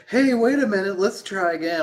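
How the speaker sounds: high, loud mock woman's voice; imitated woman calling out. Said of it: "then she's like".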